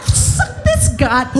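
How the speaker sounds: very high, mock-defiant comeback; high-pitched retort. Comic talking back to God in a quarrel, high mock defiance.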